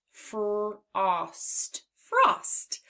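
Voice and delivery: playful emphasis on frost; stretched word frost. Shown as a demonstration. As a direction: draw out "frost" then lift it. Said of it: "in the word frost".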